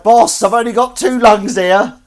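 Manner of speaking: high, slow, shouted complaint; mock protest. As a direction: holler back at the boss in play. Acts protesting to pretend boss.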